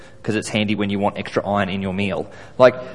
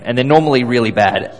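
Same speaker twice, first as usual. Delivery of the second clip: normal talking voice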